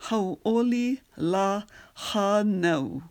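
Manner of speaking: slow pronunciation demo; syllable by syllable. Shown as a demonstration. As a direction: space the syllables out for a learner. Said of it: "the next two syllables".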